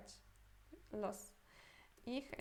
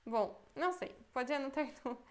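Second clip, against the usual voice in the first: normal talking voice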